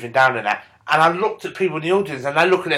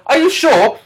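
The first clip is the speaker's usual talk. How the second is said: higher, louder doubt; mock suspicious question; 12 semitones higher, 6 dB louder than usual. Boyfriend questioning girlfriend, higher mock doubt. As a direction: push back sceptically. Man challenging woman.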